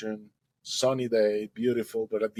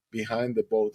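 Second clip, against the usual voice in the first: ordinary delivery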